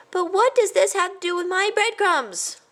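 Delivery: high, puzzled mouse voice. A narrator's reading. A baffled cousin asks about crumbs, high. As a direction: ask baffled.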